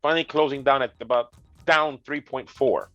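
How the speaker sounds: normal talking voice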